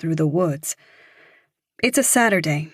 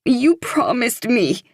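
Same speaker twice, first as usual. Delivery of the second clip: louder, high tearful accusation; sobbing; 6 semitones higher, 4 dB louder than usual. A narrator's reading. Evie accusing Claire through tears, high loud sob. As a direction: push the hurt out through tears. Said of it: "Evie starts to sob".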